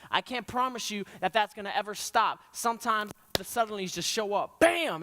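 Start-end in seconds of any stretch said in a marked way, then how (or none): 4.6-5.0 sharp, higher bang of a word; startled shout of bam; sudden exclamation. Acted. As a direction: hit it like a sudden blow.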